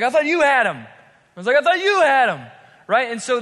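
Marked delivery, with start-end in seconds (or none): 0.0-0.8 loud, high accusing exclamation; indignant crowd member. Acted. Angry mob blaming each other. 1.6-2.5 loud, high blame thrown back; indignant retort. Acted. Mob member blames another back.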